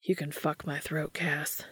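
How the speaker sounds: soft, slowing, breathy confession; Dean's low seductive whisper. A narrator's reading. Dean inviting Cass.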